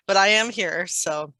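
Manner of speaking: ordinary talk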